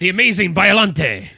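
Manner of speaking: luchador ring announcer voice; booming wrestler boasts. Acted. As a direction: hype up the wrestler's name. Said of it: "he sounds like a luchador".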